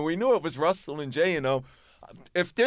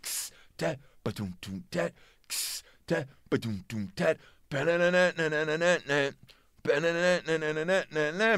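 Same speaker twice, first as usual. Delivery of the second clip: imitated record intro. Playing the record in the basement, vocal imitation of drums and guitar. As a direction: mouth the drum beat, then the riff. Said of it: "let the record play. It said".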